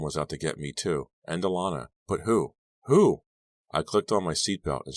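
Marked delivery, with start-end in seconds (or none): 2.1-3.2 fearful. A narrator's reading. Frightened narrator asks herself.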